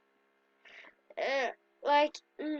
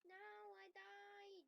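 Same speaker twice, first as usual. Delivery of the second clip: ordinary delivery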